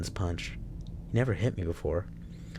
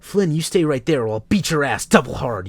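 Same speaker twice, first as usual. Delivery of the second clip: louder, higher menacing order; threatening warning; 6 semitones higher, 9 dB louder than usual. Acted. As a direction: warn him off hard. Leo threatens Flynn.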